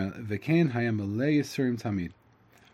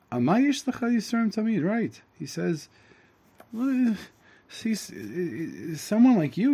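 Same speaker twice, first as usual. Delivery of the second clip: high, loud, giddy simple man; the simple Tam, gleefully teasing the wise man; excited Tam; 8 semitones higher, 4 dB louder than usual. Acted. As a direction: bounce it up like an eager kid. Teacher voices the excited Tam.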